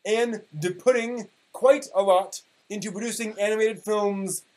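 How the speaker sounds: mock-grand reading of an old forum post; dramatic reading. Podcast host reading an old anime forum post, mock-dramatic. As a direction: read it like a solemn proclamation. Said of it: "I will be giving you a dramatic reading".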